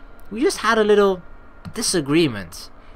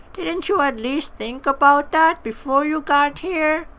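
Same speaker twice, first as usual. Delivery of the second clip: put-on cranky old innkeeper; 7 semitones higher, slower than usual. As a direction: scold them like a fussy landlady.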